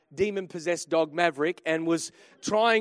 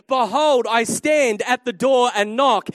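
proclaiming scripture; 8 dB louder than usual. Acted. Preacher quoting scripture climactically.

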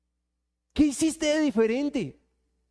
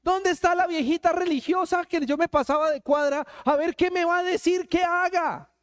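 hammed-up wail of someone suddenly needing help; mock desperate pleading; 4 semitones higher than usual. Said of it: "Ahí sí". (súplica desesperada y exagerada)

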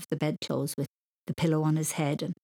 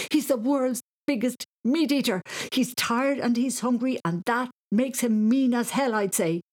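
panicked; 6 semitones higher, 3 dB louder than usual. A narrator's reading. Panicked boy warning dad.

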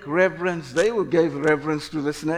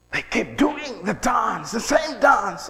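fervent preaching shout; 4 semitones higher than usual. Real, not acted. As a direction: shout it as the sermon's punchline. Preacher drives home the point.

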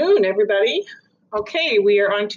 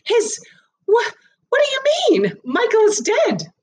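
very high, shocked stammer; mother told her dead son lives; stunned mother. Acted. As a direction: stumble up into disbelief.

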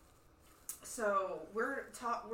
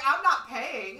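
defiant shout; 3 semitones higher, 11 dB louder, slower than usual. Retold or imitated. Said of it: "That's when I'm like".

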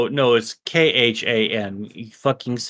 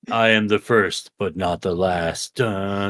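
low, hushed ominous declaration; mock-ominous; 7 semitones lower than usual. Bitch-talk rival answers the first-person jab, mock-ominous. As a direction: play it as a doom-laden prophecy, low.

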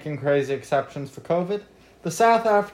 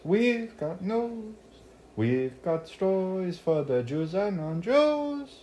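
slow, drawn-out show intro; slow announcer voice; 5 semitones higher, 3 dB quieter, much slower than usual. Acted. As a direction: announce it slowly like a host.

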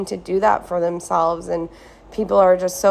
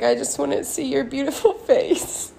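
tearful laugh; 6 semitones higher than usual. Real, not acted. Quoting mom, tearful.

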